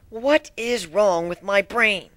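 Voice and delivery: usual speaking voice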